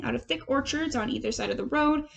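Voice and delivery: ordinary delivery, nothing marked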